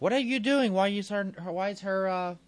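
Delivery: hammed-up nurse checking the monitor; alarmed nurse. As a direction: squeal it like a flustered nurse. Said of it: "she's like".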